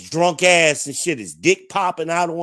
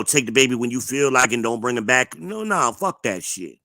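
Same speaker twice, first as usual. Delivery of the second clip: usual speaking voice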